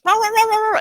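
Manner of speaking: high, loud rapid-fire yapping; shrill string of mock barks; imitated dog barking. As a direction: bark like an excited dog.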